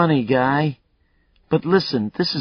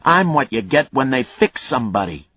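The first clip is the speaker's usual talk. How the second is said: a hint of a growl, louder and higher; 6 semitones higher, 7 dB louder than usual. Killer growling at the cop about being fixed. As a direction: snarl back at being fixed. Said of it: "Mayle's voice turned to a growl".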